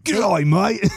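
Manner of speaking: laconic outback drawl; Australian accent. Pretend. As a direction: ghost of Steve Irwin, Aussie. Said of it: "We got Steve Irwin on the line".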